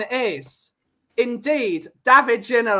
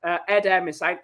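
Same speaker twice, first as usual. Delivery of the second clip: ordinary delivery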